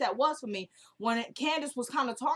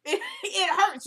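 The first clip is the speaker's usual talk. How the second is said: pained laugh, higher and slow; a slow, laughing it hurts; 8 semitones higher, slower than usual. Real, not acted. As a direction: laugh through the hurt.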